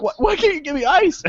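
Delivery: high, exasperated mock outcry; incredulous protest. Baffled customer arguing with the Taco Bell guy, incredulous. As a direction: plead in disbelief, pitch way up. Retold argument with the counter guy.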